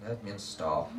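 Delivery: low mumbled class reply; muttered response. Shown as a demonstration. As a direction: mumble it like bored kids. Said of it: "and they".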